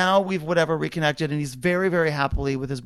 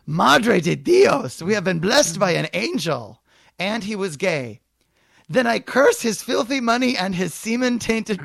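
mock Mexican mother; 3 semitones higher than usual. Reading Mrs Garcia's lines to the manager, hammed-up Mexican accent. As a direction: overplay her outrage in a put-on accent.

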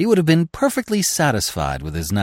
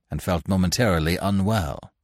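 ordinary delivery

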